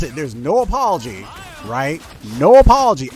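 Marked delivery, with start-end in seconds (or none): 2.4-3.1 indignant outburst. Real, not acted. Angry rant about troll teammate.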